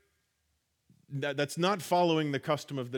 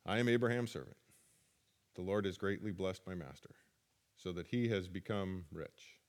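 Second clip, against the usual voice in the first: low, soft and dull, a lifeless recital; flat reading; 10 semitones lower, 8 dB quieter than usual. Shown as a demonstration. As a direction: read it like a bored list. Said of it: "You think that's how he said it? I don't".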